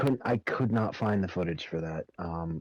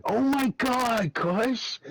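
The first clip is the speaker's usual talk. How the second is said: very high, drawn-out mock scream; bad Annoyed impersonation; 4 dB louder, much slower than usual. Friend mimics teammate's reaction to the explosion, high mock wail. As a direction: wail it slowly in an exaggerated high voice. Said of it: "That was bad impersonation".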